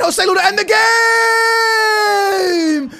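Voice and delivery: long drawn-out, high, loud, crying yell; ecstatic goal scream. Real, not acted. Gamer screaming his striker's goal to end the game, ecstatic. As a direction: stretch every syllable in wild joy.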